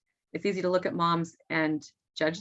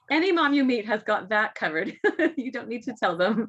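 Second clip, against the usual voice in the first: laughing; 5 semitones higher, 4 dB louder than usual. Real, not acted. Laughing about moms judging themselves.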